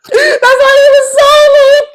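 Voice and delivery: very high, loud, slow squeal through laughter; laughing squeal. Real, not acted. Cracking up at a friend who forgot Jeopardy, laughing squeal. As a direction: squeal the words out while cracking up.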